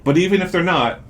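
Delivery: upbeat, high sales pitch voice; ad read. Acted. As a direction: pitch it like a commercial.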